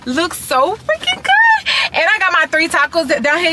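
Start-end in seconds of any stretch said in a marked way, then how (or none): 0.1-1.7 delighted. Real, not acted. Admiring the Mexican corn.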